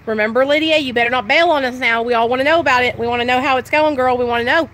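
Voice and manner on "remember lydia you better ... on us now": ordinary talk, nothing marked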